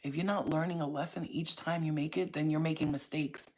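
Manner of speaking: ordinary delivery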